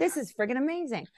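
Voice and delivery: high-pitched happy exclamation; mock-amazed delight. Celebrating how little moments add up, high-pitched delight. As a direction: marvel aloud, pitch lifted.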